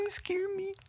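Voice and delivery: put-on tiny, extremely high toddler voice; very high-pitched small-child plea; child imitation. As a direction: squeak it like a little kid. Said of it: "She does, like".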